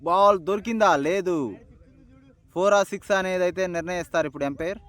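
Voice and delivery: lively high play-by-play call; excited cricket commentary. Real, not acted. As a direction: call the shot as it happens.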